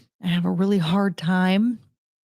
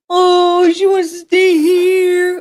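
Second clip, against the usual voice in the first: gushing pet talk; 11 semitones higher, 9 dB louder, slower than usual. Real, not acted. Owner gushing that the dog wants to stay, loud baby talk. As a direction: wail it adoringly, slow and high.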